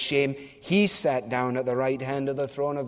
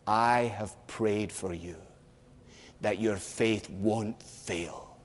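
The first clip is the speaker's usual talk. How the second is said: softer, slower, tender promise; tender reassurance; 3 semitones lower, 6 dB quieter, slower than usual. Acted. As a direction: comfort a broken friend softly. Jesus' tender words to Peter.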